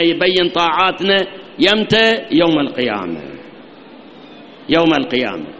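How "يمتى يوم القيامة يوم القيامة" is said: ordinary delivery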